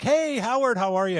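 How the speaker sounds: high, loud, excited hello; greeting Howard at the front of the line, high and excited; excited greeting call. Retold or imitated. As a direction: call out to an old friend, delighted.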